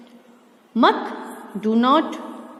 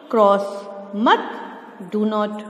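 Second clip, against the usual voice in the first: ordinary delivery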